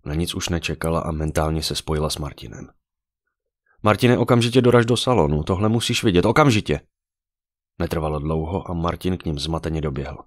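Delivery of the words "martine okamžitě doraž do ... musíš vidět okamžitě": raised, higher-pitched urgent call; insistent, pressing order; urgent summons. A narrator's reading. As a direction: demand that he come at once. Urgent mental summons to Martin.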